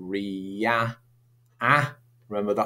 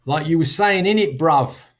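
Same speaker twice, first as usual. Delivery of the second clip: loud, higher London street accent; London MLE, pitched up and punchy; multicultural London English; 7 semitones higher, 6 dB louder than usual. Shown as a demonstration. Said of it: "characteristic of multicultural London English".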